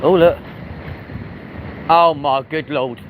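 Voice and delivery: ordinary talk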